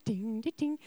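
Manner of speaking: a mother's habit of dinging while worshipping; imitating a bell. Shown as a demonstration. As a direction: ring out each ding like a little bell. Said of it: "jeg gjør sånn".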